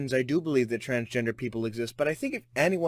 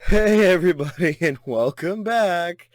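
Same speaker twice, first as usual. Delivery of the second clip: laughing; 4 semitones higher, 7 dB louder than usual. Real, not acted. Laughing intro greeting.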